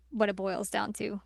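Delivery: usual speaking voice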